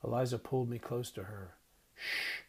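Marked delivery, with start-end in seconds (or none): 1.9-2.4 soft, hushed shush; quiet her gently; a soft calming hush; gentle shush. A narrator's reading. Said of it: "she said gently".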